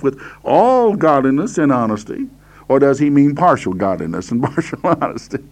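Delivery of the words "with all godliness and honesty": "all" pushed out strongly; stress on all. Shown as a demonstration. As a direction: make "all" stand out from the verse. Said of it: "I'm emphasizing the word all here".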